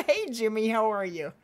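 ordinary delivery

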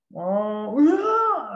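sudden high, loud swoop; fluctuating voice. Shown as a demonstration. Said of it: "nggak fluktuatif".

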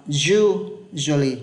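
"usually" drawn out, first syllable punched; stressed first syllable. Shown as a demonstration. Teacher showing students the stressed sound, slow stressed "usually". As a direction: lean long and hard on the start of "usually". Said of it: "âm đấy sẽ nhấn mạnh nhất".

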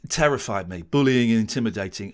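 ordinary talk

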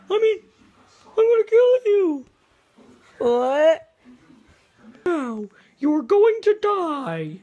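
high loud villain threat; loud menacing threat. Pretend. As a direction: yell it like a menace.

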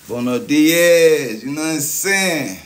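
hammed-up Spanish hello; big greeting. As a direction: belt it out to the whole chat.

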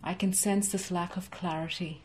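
normal talking voice

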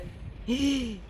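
sharp high gasp; startled gasp. Real, not acted. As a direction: catch your breath in fright. Startled by what he sees.

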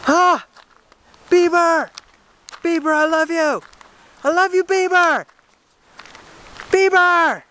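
high, loud shrieking fan voice; screaming fangirl. Acted. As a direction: shriek like a starstruck teen fan. Fan shrieking at [name] sighting.

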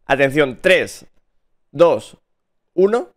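slow, loud, building countdown. Real, not acted. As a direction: call out each number. Countdown to big reveal.

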